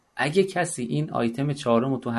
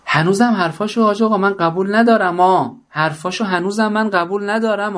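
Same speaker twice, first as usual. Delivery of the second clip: put-on higher and louder, a defiant young woman; stubborn young woman; 5 semitones higher, 5 dB louder than usual. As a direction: play the woman who still disagrees, pitched up and loud. Woman voiced in the story.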